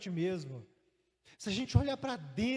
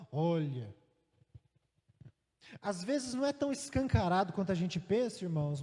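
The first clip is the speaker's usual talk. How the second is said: ordinary delivery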